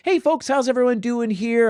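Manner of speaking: high, sing-song server greeting; bubbly server. Retold or imitated. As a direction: chirp it like a peppy waiter. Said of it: "the bubbly server comes over".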